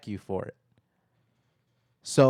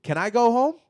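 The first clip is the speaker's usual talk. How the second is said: 2 higher, faster, fed-up mock retort; 3 toss it back at the cop, annoyed; 1 exasperated retort; 6 semitones higher than usual. 4 telling the police he just wants the subway, exasperated retort. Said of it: "And I'm like".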